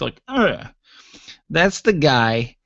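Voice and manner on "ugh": disgusted grunt. Shown as a demonstration. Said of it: "When you see something you don't like".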